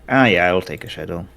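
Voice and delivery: ordinary delivery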